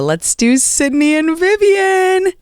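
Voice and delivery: high, drawn-out caller introduction; rising high and slow, welcoming callers on; sing-song call-out. Real, not acted. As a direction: sing out the names, stretching them. Host calling callers onto the air.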